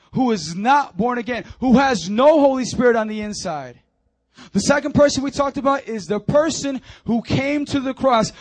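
loud, high, urgent preacher; full-force preaching. Real, not acted. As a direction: drive each phrase home to the congregation.

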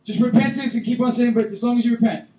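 high, loud hammed-up permissive preacher; loud mock permissive voice; mock lenient version. Shown as a demonstration. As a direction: parody a careless preacher, loudly. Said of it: "Is that what he's saying?".

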